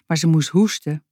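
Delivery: ordinary delivery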